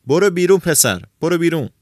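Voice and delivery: high, loud order to get out; angry shout. A narrator's reading. Old man throws the fool out, angry shouting. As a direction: shout the boy out the door. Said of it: "ماوراندونی پیر داد زد".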